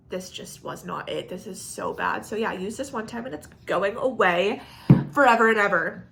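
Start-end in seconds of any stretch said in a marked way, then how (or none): 0.1-2.2 quietly write the product off; muttered verdict. Real, not acted. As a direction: drop to a low, soft disappointed verdict.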